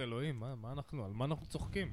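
quiet. Retold or imitated. Self-quote, much quieter.

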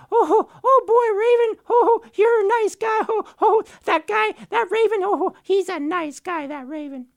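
very high, loud chirpy chatter; tiny birds gushing over Raven; squeaky overjoyed bird voices; excited little birds. Acted.

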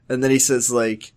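ordinary talk, nothing marked

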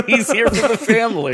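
laughing high through the joke; giggling punchline. Real, not acted. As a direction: crack up while saying it. Joking Vin Diesel family bit.

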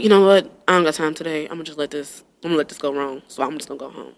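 low, soft acted shrug-off; quiet resignation. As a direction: give up under your breath. Acts out giving up quietly.

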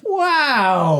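high, drawn-out wow; hammed-up astonishment; mock amazement. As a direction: stretch the exclamation, falling from a high note. Co-host reacts with mock amazement.

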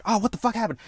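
high-pitched acted alarm; mild startled cry. Captain shaken out of bed, mildly startled. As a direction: lightly act a rude awakening.

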